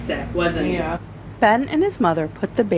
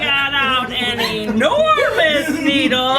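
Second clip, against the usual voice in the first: ordinary delivery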